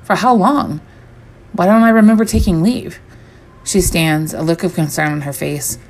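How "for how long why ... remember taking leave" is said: alarmed disbelief. Acted. Panicked confusion at work.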